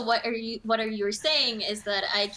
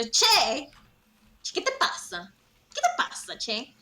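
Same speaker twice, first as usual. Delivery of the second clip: mock Argentine Spanish; 7 semitones higher than usual. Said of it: "Y yo".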